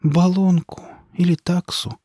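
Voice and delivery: turning the words over slowly; slow pondering repeat. A narrator's reading. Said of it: "повторила Марья Ивановна и вздохнула".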